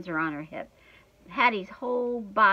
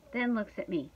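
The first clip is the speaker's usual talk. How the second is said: ordinary delivery, nothing marked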